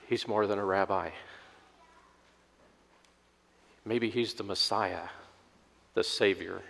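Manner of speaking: low, soft, wondering realization; hushed awe. Acted. Disciples realize he is the Messiah, hushed. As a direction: let it dawn on you quietly.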